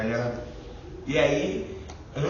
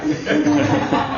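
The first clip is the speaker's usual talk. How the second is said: risada curta aguda que sobe; risada alta; 11 semitones higher, 9 dB louder than usual. Real, not acted. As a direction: ri de novo com gosto. Ri de novo. (risada aguda e curta)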